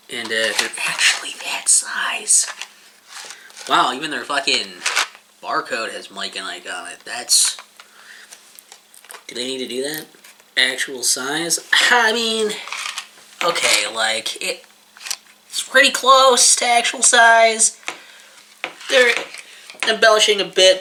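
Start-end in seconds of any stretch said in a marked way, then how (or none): 0.1-2.5 whisper. Real, not acted. Reading package text aside. 15.5-20.8 sarcastic. Real, not acted. Mocking the package claim.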